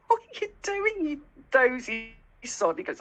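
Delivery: exasperated owner playfully telling off her dog; mock scolding.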